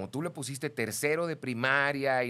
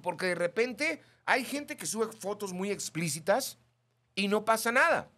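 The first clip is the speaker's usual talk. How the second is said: usual speaking voice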